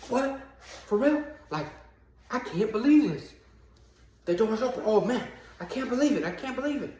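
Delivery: high, breathless disbelief at the open door; stunned disbelief. Retold or imitated. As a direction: gasp it out, hardly believing. Prisoner finds the door open.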